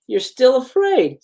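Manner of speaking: high, drawn-out playful taunt; playful teasing. A narrator's reading. Brother needling his scared sister, playfully. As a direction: tease lightly, stretching it out. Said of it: "Johnny, laughing playfully".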